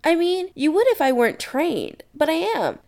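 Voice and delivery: high, eager explanation; enthusiastic. Acted. Adam to Chris about his training, enthusiastic. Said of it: "Adam says enthusiastically".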